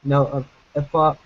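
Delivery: ordinary talk, nothing marked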